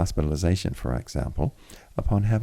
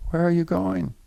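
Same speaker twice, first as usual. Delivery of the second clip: high, louder sickbed call; 13 semitones higher, 3 dB louder than usual. A narrator's reading. Jean Noël calls to Alice from his sickbed, high call. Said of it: "called from his sickbed".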